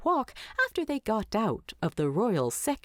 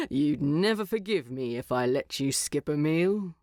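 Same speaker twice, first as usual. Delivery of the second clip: amused; 4 semitones lower than usual. Acted. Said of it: "a bit more amusement in his voice".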